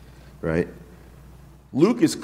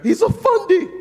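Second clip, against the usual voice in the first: mocking critics. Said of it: "they look at him and say".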